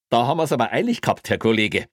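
fast, high, teasing Bavarian policeman; smug cop voice. Acted. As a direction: needle him like a smug traffic cop. Traffic cop stops colleague.

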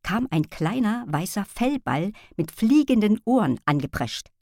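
usual speaking voice

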